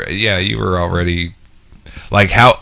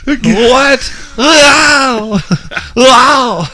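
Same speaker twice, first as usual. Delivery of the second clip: very high wailing Wookiee cries; high comic creature howl; New York Chewbacca; 3 dB louder, much slower than usual. Acted. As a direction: yowl each word like a whining beast. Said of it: "This is the New York Chewbacca".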